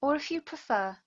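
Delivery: unhurried meditation voice with long pauses; guided meditation. Real, not acted. Yoga teacher settles students into breath awareness, guided meditation. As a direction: guide them gently into steady breathing, letting words linger.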